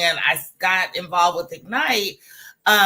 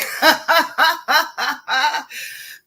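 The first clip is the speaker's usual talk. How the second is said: a high, giddy laugh; bubbling happy laugh; proud laugh; 8 semitones higher, 4 dB louder than usual. Real, not acted. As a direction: laugh with pride at the news. Laughs telling about upgrade.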